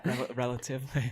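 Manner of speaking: quiet, airy mimic of relatively. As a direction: echo relatively softly. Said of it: "What was that?".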